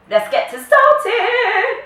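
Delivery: very high, slow, playful drawn-out call; silly high sing-song. Real, not acted. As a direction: stretch it out high and goofy. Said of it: "I don't know what's wrong with me today".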